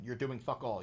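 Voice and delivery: normal talking voice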